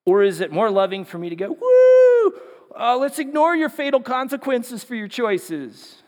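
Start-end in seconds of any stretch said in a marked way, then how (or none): 1.5-6.0 high whooping woo, then a mock-breezy dismissal; mock flippant. Preacher mocks an easygoing friend ignoring fatal consequences, flippantly. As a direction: shrug off the danger like a careless friend. Mocking a careless non-warning.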